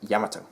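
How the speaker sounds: Yamato as 'YAM-uh-toe', short vowels; own pronunciation. Shown as a demonstration. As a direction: stress the first syllable of Yamato. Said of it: "that's the way I pronounce it".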